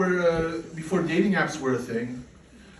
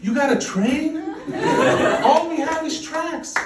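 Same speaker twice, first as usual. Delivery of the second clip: higher and louder, laughing scorn at spoiled Germans; indignant retort; 9 semitones higher, 4 dB louder than usual. Retold or imitated. Comic answering complaining Germans about trains, loud scornful mockery. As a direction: shout it up high, incredulous and mocking. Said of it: "I'm like".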